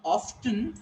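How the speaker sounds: "often" as OFF-tun; T released after the F in "often"; sounded T. Shown as a demonstration. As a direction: say "often" with a clear T. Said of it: "if I pronounce it often".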